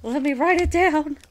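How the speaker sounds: excited, high and loud; gasp, then exclaim in triumph. Real, not acted. Player celebrating a catch they wanted, excited.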